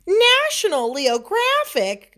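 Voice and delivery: high, slow and loud storybook mama; mama musing aloud. A narrator's reading. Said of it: "mused Mama".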